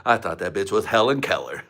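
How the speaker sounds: ordinary talk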